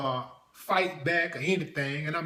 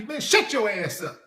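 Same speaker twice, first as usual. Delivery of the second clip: louder, higher, fed-up brush-off; annoyed shout; 5 semitones higher, 7 dB louder than usual. Pretend. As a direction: snap at him like you're busy and sick of being called. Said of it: "If my name was Father, I'd be".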